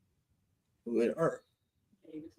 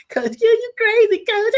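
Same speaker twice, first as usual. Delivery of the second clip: high, lightly voiced little girl; 12 semitones higher than usual. Little girl at school teasing her coach in a high voice. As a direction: squeak it like a giggling schoolgirl. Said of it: "she goes".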